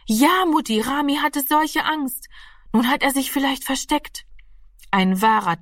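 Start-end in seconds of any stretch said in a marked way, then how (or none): 0.1-4.3 put-on a young girl blurting it out, higher; lightly voiced anxious girl, raised pitch; a girl caught out, anxious and higher; girl's voice. Voices girl Martina in dialogue.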